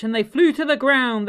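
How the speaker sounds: ordinary delivery, nothing marked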